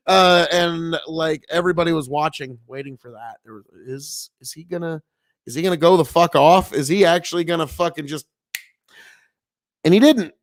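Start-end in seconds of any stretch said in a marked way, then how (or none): none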